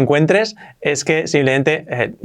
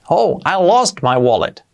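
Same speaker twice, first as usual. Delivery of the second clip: normal talking voice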